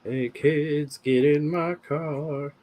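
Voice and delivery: put-on slow, high creepy child-luring voice; creepy stranger voice. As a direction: lure kids in like a creep. Creepy kidnapper impression.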